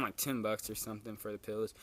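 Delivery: normal talking voice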